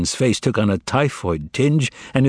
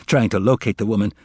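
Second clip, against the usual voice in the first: ordinary delivery, nothing marked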